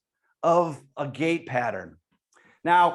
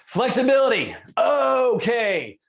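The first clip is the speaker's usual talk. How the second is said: excited announcement; 7 semitones higher, 4 dB louder, slower than usual. Real, not acted.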